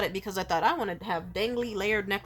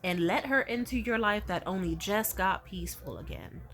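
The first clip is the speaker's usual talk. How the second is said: ordinary delivery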